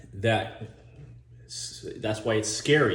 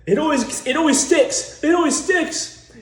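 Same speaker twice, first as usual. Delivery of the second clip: much higher and louder, flustered; frantic driver; 6 dB louder than usual. Retold or imitated. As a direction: whine it as the stuck driver panics. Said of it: "He's like".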